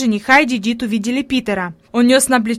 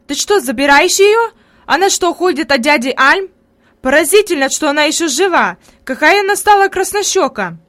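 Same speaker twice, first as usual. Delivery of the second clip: surprised, higher and louder cries; exclamations; 5 semitones higher, 5 dB louder than usual. A narrator's reading. Neighbours call out to the aunt in amazement. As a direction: villagers marvelling aloud. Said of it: "Подобные восклицания".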